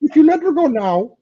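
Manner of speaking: high Kermit threat; Kermit doing Liam Neeson. Acted. Host imitating Kermit as Liam Neeson, high Kermit voice. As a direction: menace in a frog puppet voice. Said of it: "In Kermit's voice".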